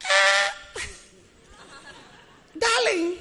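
sweet and soft, high and tender; soft gentle voice. Shown as a demonstration. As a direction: coax him gently like a loving wife. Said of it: "your soft voice is necessary".

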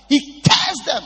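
thundering preached shout; preaching shout. Real, not acted. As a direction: bellow it out.

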